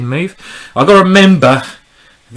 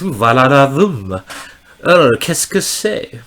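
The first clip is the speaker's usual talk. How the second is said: mock French accent. Streamer pretending to be a romantic chess player, mock French accent. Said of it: "pull out flowers and speak in a French accent".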